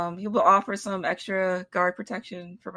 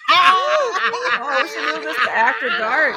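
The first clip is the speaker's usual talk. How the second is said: laughing; 8 semitones higher, 9 dB louder, slower than usual. Real, not acted. Laughing high and loud.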